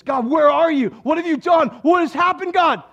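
voiced anguished prayer; desperate complaint prayer. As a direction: cry out at God for answers. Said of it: "You may start out praying with this".

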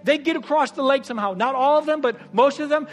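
loud, high, driving sermon delivery; preaching at full force. Acted. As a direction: preach it hard and fast to the crowd.

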